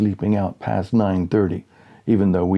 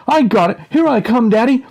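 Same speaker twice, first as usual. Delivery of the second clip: put-on excited kid; 14 semitones higher, 6 dB louder than usual. Kid charging with pole.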